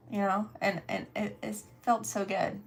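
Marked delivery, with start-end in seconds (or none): none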